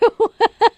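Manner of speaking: high, loud burst of laughter; real laugh. Real, not acted. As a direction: laugh at your own cheek. Laughing right after the yell.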